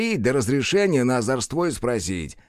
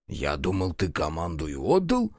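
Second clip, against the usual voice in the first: low, soft puzzled question; understated surprise; 6 semitones lower, 4 dB quieter than usual. A narrator's reading. Buchilo answering the constable's rebuke, quietly puzzled. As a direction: ask it quietly, dropping the pitch. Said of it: "удивился Бучило".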